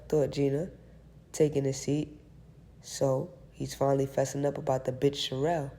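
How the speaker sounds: plain reading voice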